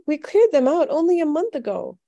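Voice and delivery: put-on high-pitched child's complaint; dismayed protest. A narrator's reading. Young brother protesting the gnomes' return, high dismay. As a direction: keep it high and exasperated. Same boy still crying out.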